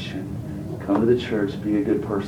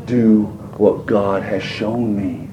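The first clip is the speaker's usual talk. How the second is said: gentle plea; 6 semitones lower, 5 dB quieter, much slower than usual. Retold or imitated. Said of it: "He said".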